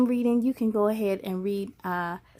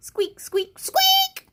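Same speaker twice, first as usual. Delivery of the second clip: squeaks ending very high; mouse squeaks; much slower than usual. Pretend.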